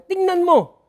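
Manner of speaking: high, loud, hurried protest; angry complaint. Acted. Pharisees angrily complaining to Jesus about his disciples. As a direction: snap it at Jesus in indignation. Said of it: "Galit na sila kay Jesus".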